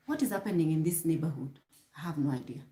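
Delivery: low, soft muttered aside; quiet aside. Real, not acted. Vlogger breaking off to wonder about the neighborhood, quiet aside. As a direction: drop right down as if talking to yourself.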